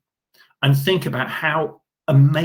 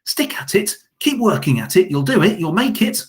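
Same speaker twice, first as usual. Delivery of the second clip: much higher, cheering teacher voice; encouraging teacher; 8 semitones higher than usual. Retold or imitated. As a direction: cheer them on like a proud teacher. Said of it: "your teacher will always say".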